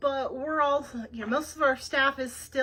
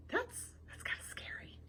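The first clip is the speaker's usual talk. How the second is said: hushed, breathy whisper; scared whisper; 10 semitones higher, 15 dB quieter than usual. Real, not acted. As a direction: whisper it like a spooky secret. Reader's aside about scary fly.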